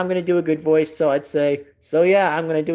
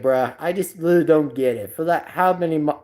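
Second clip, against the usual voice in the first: ordinary delivery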